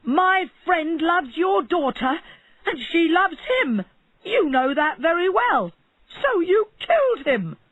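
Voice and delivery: accusing barber; angry accusation. A narrator's reading. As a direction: throw the blame at him.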